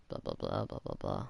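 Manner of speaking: whispered blah, blah, blah, barely any voice. Shown as a demonstration. Gamer skipping text, whispering blah, blah, blah. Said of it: "skipping text, whispered".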